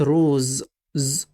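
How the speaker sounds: روز ending in a long voiced Z; buzzing Z. Shown as a demonstration. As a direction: hold the z at the end of روز. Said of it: "إمتى يتنطق Z". (انطق آخر «روز» ز طويلة)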